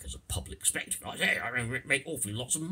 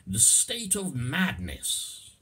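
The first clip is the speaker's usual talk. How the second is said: slow emphasis; 9 semitones higher, 4 dB louder, much slower than usual. Shown as a demonstration. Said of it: "slow example".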